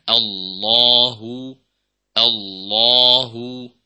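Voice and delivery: Allahu with lips only slightly pursed, slow; slightly rounded hu. Shown as a demonstration. Teacher showing the moderate rounding on Allahu. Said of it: "menyempurnakan huruf dhammahnya".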